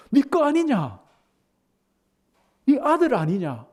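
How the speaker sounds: father begging his elder son to see; pleading father. Retold or imitated. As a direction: plead with the son, pitch up high. Father voiced, higher.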